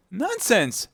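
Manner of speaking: high, loud, firm retort; loud and decided. Acted. Alice defying the queen, very loud and decided. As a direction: cut in loudly and decidedly. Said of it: "very loudly and decidedly".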